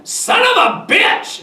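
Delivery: very high, drawn-out exasperated curse; cussing. A narrator's reading. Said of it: "Kenneth cussed again".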